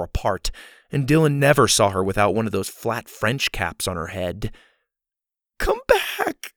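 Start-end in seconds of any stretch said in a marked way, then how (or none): 5.6-6.5 very high, strained cry; hammed-up feeble call for rescue. A narrator's reading. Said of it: "continued in her strained voice".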